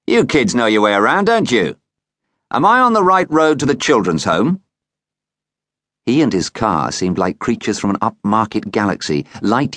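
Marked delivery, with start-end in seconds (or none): 0.1-4.6 friendly man asking directions, pitched higher; breezy, confident question; cheerful stranger. A narrator's reading. Stranger in a car asks kids the way, breezy and cheerful. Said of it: "he cried cheerfully".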